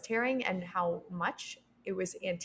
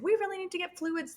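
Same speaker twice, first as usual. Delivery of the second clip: higher, pressing midwife voice; urgent midwife; 11 semitones higher than usual. Retold or imitated. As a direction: urge it like the anxious midwife. Midwife urging about fluids.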